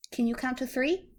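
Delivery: ordinary delivery, nothing marked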